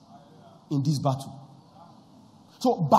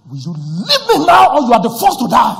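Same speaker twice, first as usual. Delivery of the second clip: full-throated yell, much louder and higher; furious shout; 6 semitones higher, 13 dB louder than usual. Retold or imitated. Preacher yells at his wife to let him go, furious shout. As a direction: roar at whoever holds you back.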